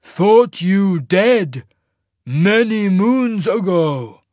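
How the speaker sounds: slow, loud, high-rising giant voice; surprised rumbling giant. A narrator's reading. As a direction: boom it out, drawn out in astonishment. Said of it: "sounding surprised".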